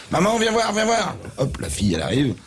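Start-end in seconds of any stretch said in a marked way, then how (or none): 0.1-1.2 high urgent call to mum. Acted. Grandson calls his mother.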